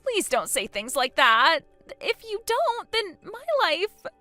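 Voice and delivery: high, drawn-out plea; slow, panicked pleading; desperate begging. Acted. Begs for her life.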